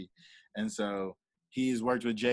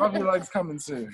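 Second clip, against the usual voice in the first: higher, laughing through the promise; real amused laugh at the end; 9 semitones higher, 4 dB louder than usual. Real, not acted. Laughing about the coffee mug.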